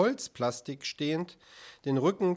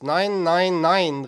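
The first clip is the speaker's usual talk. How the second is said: loud, raised string of refusals; calling out; 4 semitones higher, 9 dB louder, much slower than usual. A narrator's reading. Director striding through the museum, calling out in refusal. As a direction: cry it out in emphatic protest. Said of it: "rief er".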